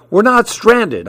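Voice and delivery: high, lightly shouted denial; mild shout. A narrator's reading. Jack denying to Betsy, mildly shouting. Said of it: "I shouted".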